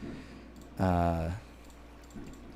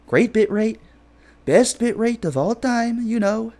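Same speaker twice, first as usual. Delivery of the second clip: much higher, slower, puffed-up Trump boast; Trump impression; 12 semitones higher, 4 dB louder than usual. Streamer shows off his Trump impression to chat, high and boastful. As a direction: brag about the bitrate like a showman. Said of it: "I can do my Trump impression here".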